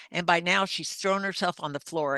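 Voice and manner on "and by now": ordinary talk, nothing marked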